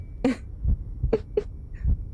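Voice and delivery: quiet unvoiced chuckle; a little amused breath of laughter; soft breathy laugh. Real, not acted. Laughs at own recognition.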